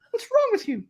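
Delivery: high mock British scolding; wife scolding. Wife scolding husband, high mock voice. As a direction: chide like the wife. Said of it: "she was like".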